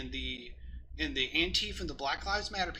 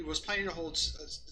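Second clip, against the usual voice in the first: usual speaking voice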